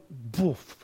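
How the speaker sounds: put-on low, soft foreign-accented puff; a hint of French accent; mock foreign accent. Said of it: "had a foreign accent on it".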